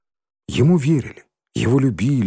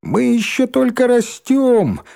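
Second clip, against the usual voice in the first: high squeaky slow voice; 13 semitones higher, 3 dB louder, slower than usual. A narrator's reading. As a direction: squeak it like a little kid. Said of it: "писклявым голоском вставил Рыжий".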